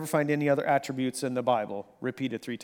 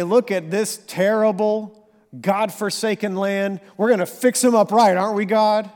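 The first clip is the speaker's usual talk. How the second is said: higher and louder, smug preachy gloating; mock self-righteous gloat; 6 semitones higher, 7 dB louder than usual. Prophet pointing at the sinful land, smug mocking gloat. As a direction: sneer like a proud prophet judging others. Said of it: "He doesn't say".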